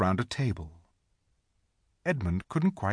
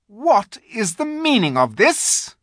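high, loud icy demand; witch queen; 10 semitones higher, 7 dB louder than usual. A narrator's reading. Witch queen confronting the startled feast. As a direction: loom over the frightened animals. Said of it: "asked the witch queen".

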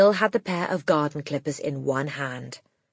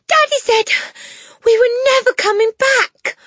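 put-on high, loud childlike wail; anguished cry; 9 dB louder than usual. A narrator's reading. Matilda telling teacher it is for good, a high anguished cry. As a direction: cry it out in despair. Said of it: "Matilda cried".

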